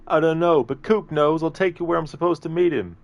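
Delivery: high-pitched, pleading movie henchman; desperate captive pleading. Acted. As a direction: plead for your life in a high voice. Captive pleads in acted movie scene.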